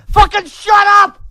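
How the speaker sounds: slow screamed command; screaming to silence them; furious scream. Real, not acted. Yelling at critics.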